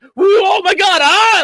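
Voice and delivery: very high mock cartoon yelp; hammed-up high-pitched accident; cartoon panic. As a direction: fake a clumsy cartoon tumble, loud and high. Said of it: "like a fucking cartoon".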